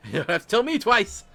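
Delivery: high, loud eager cry; eager gnome. Acted. Fleeing gnome to the monk, eager loud yelp. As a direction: shout it while already running.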